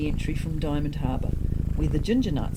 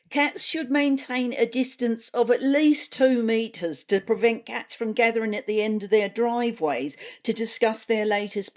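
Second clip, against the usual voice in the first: slightly raised, preachy bureaucrat voice; pompous official briefing; 4 semitones higher than usual. Acted. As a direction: press on with the silly rules, dead serious. Susie continues relentlessly.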